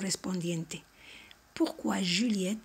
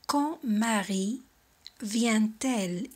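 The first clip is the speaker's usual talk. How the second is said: slow model question; much slower than usual. Shown as a demonstration. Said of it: "y ponemos María".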